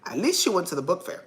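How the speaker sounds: real nervous laughter through the words; giggling while saying it; laughing. Real, not acted. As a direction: laugh it out half whispered. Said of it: "but I'm laughing because I said".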